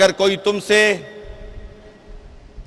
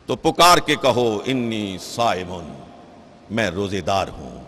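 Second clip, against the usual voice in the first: low, soft statement; softly lowered preacher's voice; hushed declaration; 10 semitones lower, 6 dB quieter than usual. Acted. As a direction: let the call sink low and quiet. Same call repeated, now softly.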